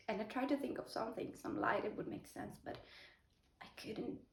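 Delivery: normal talking voice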